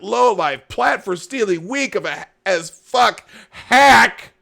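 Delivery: drawn-out, high, loud read-aloud fury; angry rant. Acted. Host acting out a Bernie supporter's tweet, angry. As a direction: build from slow seething to shouting. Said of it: "Thea is not happy".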